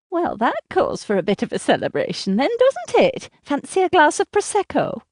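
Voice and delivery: high-pitched nasal, chatty seatmate; grating, nasal. A narrator's reading. Seatmate inviting a stranger to celebrate, grating and nasal. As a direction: lilt up high as you offer a drink. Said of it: "She has a voice that grates, almost nasal".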